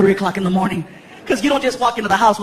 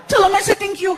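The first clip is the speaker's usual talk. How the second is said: put-on little boy; 10 semitones higher than usual. Son Frankie walking into the kitchen, high kid voice. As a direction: play a sleepy excited kid.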